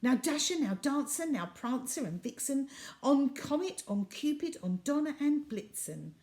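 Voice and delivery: rally the reindeer by name, ringing out; calling reindeer. Acted. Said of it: "he whistled and shouted and called them by name".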